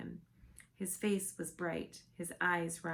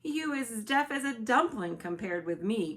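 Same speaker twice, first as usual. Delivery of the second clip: loud, higher giant's exclamation; the BFG bursting out loudly; crying out; 5 semitones higher, 8 dB louder than usual. A narrator's reading. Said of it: "cried the B F G".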